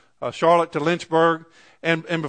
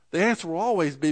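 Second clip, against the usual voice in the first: ordinary talk, nothing marked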